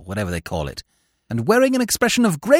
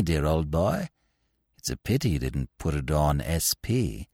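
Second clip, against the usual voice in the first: lower, softer, oily gloating; smug Steggles; 12 semitones lower, 4 dB quieter than usual. Acted. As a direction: purr out the bad news sweetly. Steggles gloats over the bets.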